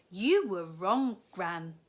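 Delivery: put-on loud, slow teasing girl; girl proving Gran wrong; Katy's voice. A narrator's reading. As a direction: gloat a little, slowly. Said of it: "said Katy".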